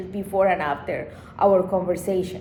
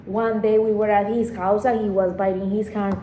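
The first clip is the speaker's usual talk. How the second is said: usual speaking voice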